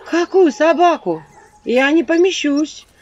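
high, loud, slow alarm; a shocked, rising protest; horrified. Acted. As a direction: panic at the absurd order. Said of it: "ужаснулась я".

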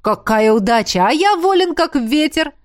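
high, loud, gleeful outburst; jubilant, flirtatious exclamation; eager delight. A narrator's reading. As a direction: jump in with exaggerated enthusiasm. Eagerly seizing on her flirtation.

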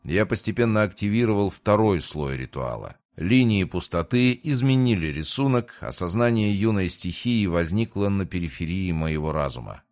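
ordinary delivery, nothing marked

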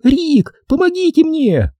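very high-pitched desperate cry; frantic woman's outburst; wild outburst. Acted. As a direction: plead for help in shrieky female panic. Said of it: "неожиданно взорваться с дикой силой".

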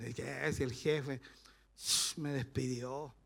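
low, slow, dragged-out moan; hammed-up sulky complaint, soft and slow; mock complaining. Fired worker blaming the boss, mock complaining.

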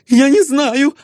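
put-on high, startled girl's answer; high startled denial; frightened young lady. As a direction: blurt it out like a scared young lady. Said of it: "ответила она".

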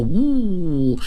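long drawn-out "woo" whoosh; imitated weapon whoosh. Lance swung at Luo Cheng's head, imitated whoosh. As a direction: voice the lance swinging down.

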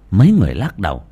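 plain reading voice

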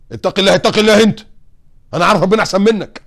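hammed-up pious scolding; sanctimonious know-it-all. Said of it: "انا بسميهم ابو اللميس".

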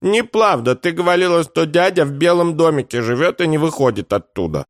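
put-on high childish protest; very high kid's voice, indignant; indignant little boy. A narrator's reading. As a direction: argue back like an outraged small child. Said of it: "возмутился малыш".

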